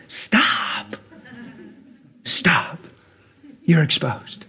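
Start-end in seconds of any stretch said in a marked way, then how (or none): none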